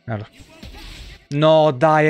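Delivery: ordinary talk, nothing marked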